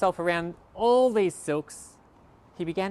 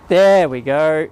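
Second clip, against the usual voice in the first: magic reveal; 5 semitones lower, 12 dB louder, much slower than usual. Acted.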